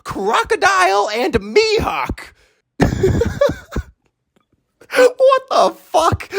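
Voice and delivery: very high, drawn-out squeal cracking into laughter; hammed-up disbelief. Fan reacting to an absurd plot twist, hammed-up disbelief. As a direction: squeak it out, stretched and incredulous.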